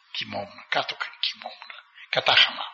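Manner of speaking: a quiet, humble aside; soft, near whisper. Retold or imitated. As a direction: murmur it humbly. Poor hermit describes sleeping on ground.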